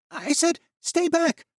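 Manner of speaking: very high, loud warning scream; a wounded boy screaming at them to stay back; furious shout. Acted. Jan repeating his threat.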